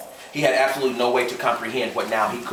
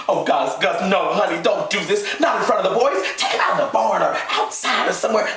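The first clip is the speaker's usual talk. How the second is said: frantic begging; 9 semitones higher than usual. A narrator's reading. Emma Jean begging.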